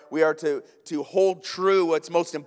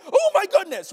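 very high, louder cry of amazement; amazed gasp; 13 semitones higher, 6 dB louder than usual. Retold or imitated. Pastor hearing the British imitate Americans: high amazed cry. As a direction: react like you just heard the impossible. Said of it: "I'm like".

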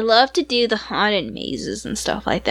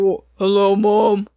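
imitating a brother's newly deep voice, lower; 4 semitones lower, slower than usual. Brother answering the phone to mom, mock deep voice. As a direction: drop low for a newly broken voice. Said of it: "it just was deep".

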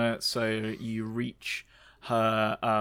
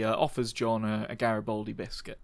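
ordinary talk

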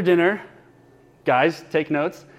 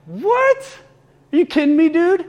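high, loud shocked outburst; stunned disbelief; 13 semitones higher, 4 dB louder than usual. Retold or imitated. To a friend who says he has no gifts, a high, loud stunned disbelief. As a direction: leap way up in pure disbelief, not buying it. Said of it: "not in missionary mode, in friend mode".

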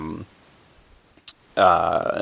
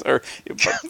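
usual speaking voice

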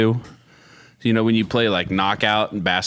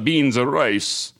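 usual speaking voice

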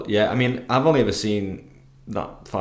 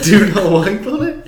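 amused disbelief; 9 semitones higher, 7 dB louder than usual. Real, not acted. Incredulous question about the tape.

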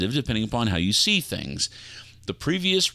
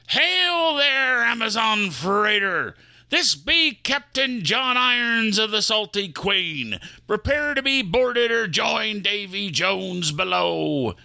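pirate hail; 10 semitones higher, slower than usual. Acted. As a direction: hail the enemy ship as a pirate. Pirate hails the Amazon van.

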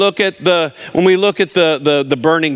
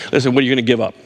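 ordinary talk, nothing marked

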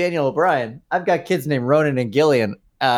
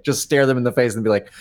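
normal talking voice

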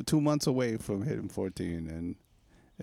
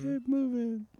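squeaky high-pitched mock order; slower than usual. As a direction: bark it in a high silly voice. Mock order repeated in put-on voice.

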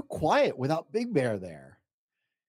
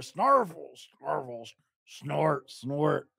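snuffly snorting noises; much slower than usual. Acted. Imitated snoring monster noises.

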